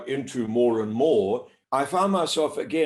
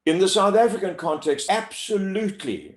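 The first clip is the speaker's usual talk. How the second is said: emphatic, a touch bumptious, starting high; slightly pompous; 7 semitones higher, 3 dB louder than usual. Real, not acted. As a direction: declare it with self-assured authority. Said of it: "bumptious or even pompous as I say that".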